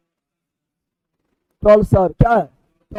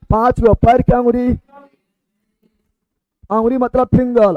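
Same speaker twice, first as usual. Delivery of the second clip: ordinary talk, nothing marked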